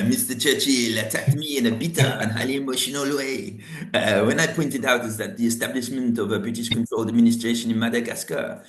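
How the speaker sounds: reading the memoir in a hammed-up French accent. Reading de Gaulle's memoir to his colleague in a mock French accent. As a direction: put on a playful mock French accent for the whole quote. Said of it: "French accent, please".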